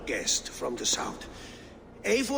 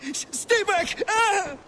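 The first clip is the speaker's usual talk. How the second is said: much higher, stammering terrified shouts; panicked cries; 12 semitones higher, 3 dB louder than usual. Acted. Cornered priest to the warrior breaking in, terrified cries. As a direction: scramble back crying out in terror.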